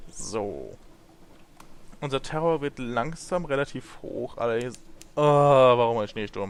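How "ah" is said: loud, drawn-out "ah"; exasperated groan. Real, not acted. Player spotting a snowstorm, loud exasperated groan. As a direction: groan loudly in annoyance.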